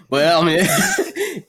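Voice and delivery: usual speaking voice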